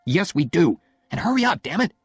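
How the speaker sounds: husband losing patience; impatient snap. Acted. Oliver snaps at Jenny.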